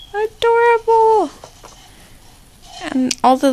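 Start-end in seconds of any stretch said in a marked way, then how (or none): 0.1-1.3 higher, delighted squeal on Adorable; sing-song Adorable, pitched up and bright. Real, not acted. Repeating word, delighted.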